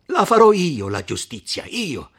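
loud, emphatic declaration of vengeance; loud vow. Acted. Renzo swearing to take justice himself, loud. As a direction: proclaim it like an oath.

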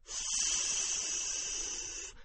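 unvoiced breathy yes; ghostly hiss. A narrator's reading. Boy answering the séance as a spirit, faint ghostly hiss.

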